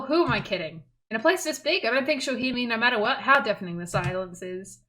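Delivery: normal talking voice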